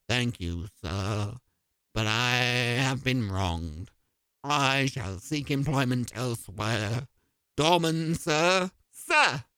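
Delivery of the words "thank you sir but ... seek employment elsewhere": haughty low butler. Acted.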